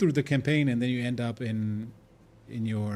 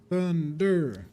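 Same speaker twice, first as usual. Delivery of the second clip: higher, louder "Thunder." rung out like a spell name; dramatic loud call; 6 semitones higher, 4 dB louder than usual. Acted. Streamer hyping up a game skill name, loud and dramatic. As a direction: call the word out with a dramatic flourish. Repeats word.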